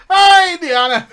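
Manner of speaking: loud, high fake Irish accent; exaggerated Irish accent, pitched high; mock Irish accent. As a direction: belt it out in put-on Irish. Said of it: "So this apparently equals Irish accent".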